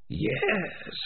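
eerie breathy spirit voice; eerie Spider. Acted. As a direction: hiss a cold yes.

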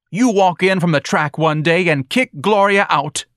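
high, loud, accusing tirade; indignant wife. A narrator's reading.

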